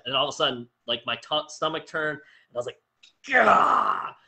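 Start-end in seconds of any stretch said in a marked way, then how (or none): 3.3-4.1 short loud high gah of dismay; frustrated groan. Retold or imitated. Coach reacting to runners missing the cutoff, a loud groan of dismay. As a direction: blurt out a gutted gah. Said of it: "I was so upset for him".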